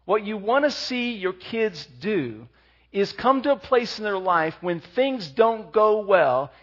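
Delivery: ordinary delivery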